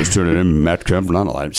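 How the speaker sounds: low mumbling sportscaster; Ross Porter. Acted. Trying new player names in the drunk announcer bit, mumbled impression. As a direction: drop the pitch and run the words together. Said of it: "a Ross Porter impression".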